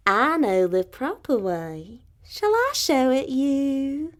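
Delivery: slow, sly fox voice. Acted. Fox slyly greeting the birds on the road, slow and smooth. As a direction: draw each name out slowly, like bait. Foxy Woxy offers the way.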